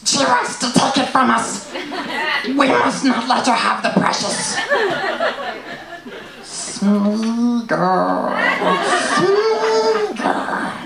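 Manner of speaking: high, hissing paranoid Gollum. Retold or imitated. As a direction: clutch the ring like Gollum, climbing shrill. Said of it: "I love that impression".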